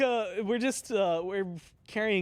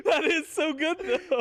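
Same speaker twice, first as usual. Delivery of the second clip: laughing; 10 semitones higher, slower than usual. Real, not acted. Laughing after turkey impression.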